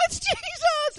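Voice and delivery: high, loud thrilled cry; ecstatic shout. Pretend. Believers in heaven seeing Jesus, crying out in delight. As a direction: shout it like you just saw the Lord. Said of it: "then they're gonna go".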